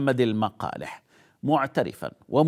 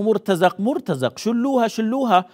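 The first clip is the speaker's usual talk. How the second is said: mocking the looters; 8 semitones higher than usual. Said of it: "قالوا".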